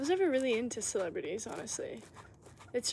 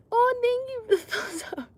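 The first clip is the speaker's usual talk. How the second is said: excited squeal; 12 semitones higher, 8 dB louder than usual. Real, not acted. Excited thanks to stream viewer.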